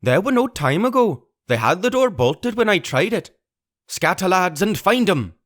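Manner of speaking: high, vicious raging voice; angry cry. Acted. Pugh rages about the boy to his men, angry. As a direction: spit the threat out.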